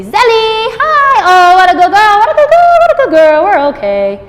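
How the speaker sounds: very high, loud, gushing dog praise; over-the-top excitement to win her back; squealing with delight at the dog; exuberant praise. Shown as a demonstration. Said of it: "I'll be more exuberant and I'll say".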